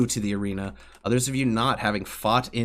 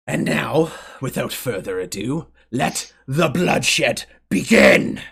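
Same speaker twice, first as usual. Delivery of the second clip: slow, louder, drawn-out proclamation; grand announcer shout; 3 semitones higher, 7 dB louder, slower than usual. Acted. Grand mage starts the games for the arena crowd, booming and slow. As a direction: stretch it out and let it boom.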